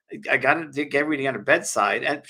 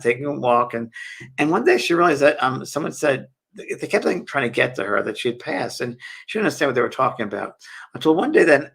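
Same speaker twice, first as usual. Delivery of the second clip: ordinary talk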